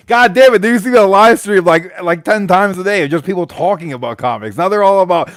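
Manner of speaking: loud, high, fed-up outburst; exasperated rant. Real, not acted. Host ranting to the guest about selling streams, loud and exasperated. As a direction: vent at the flood of selling streams.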